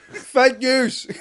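mock outrage.

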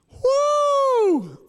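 high, drawn-out "woo"; delighted whoop. Retold or imitated. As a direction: whoop long and high with joy. Retold delight at fast delivery.

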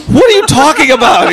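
high, exasperated mock protest; retold indignant retort. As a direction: imitate someone throwing the accusation back. Acted quarrel with neighbors.

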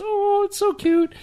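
high, slow cooing aww; mock sitcom audience aww. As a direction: coo it like a charmed studio crowd. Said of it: "everybody goes".

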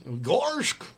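goofy cartoon dog voice; dopey cartoon ad read. Shown as a demonstration. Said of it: "a commercial where I'm goofy".

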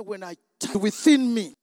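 very loud, slow, rising climax of praise; full-force preaching. Acted. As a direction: pour it out at the top of your voice. Climax of testimony.

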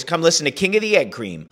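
normal talking voice

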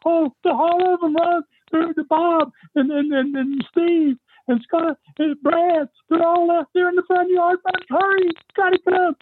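very high, frantic imitation of a wife; panicking wife. Wife telling friend her husband and boys are brawling, panicking. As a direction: mimic panicked pleading at the top of the voice. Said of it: "she's, she's panicking".

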